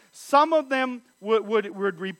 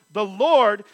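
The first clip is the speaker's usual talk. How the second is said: 'the Lord' louder than its frame; stress on the Lord; 5 dB louder than usual. Shown as a demonstration. Preacher explaining God's name, stressing the Lord. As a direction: mark 'the Lord' clearly. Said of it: "in all caps".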